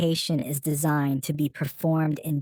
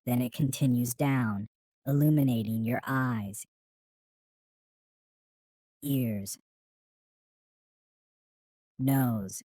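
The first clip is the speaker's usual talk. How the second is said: guided meditation. Acted. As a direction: ease the listener through each body part, calmly. Leads guided healing meditation.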